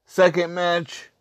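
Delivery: ordinary talk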